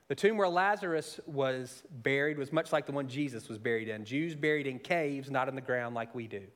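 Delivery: ordinary delivery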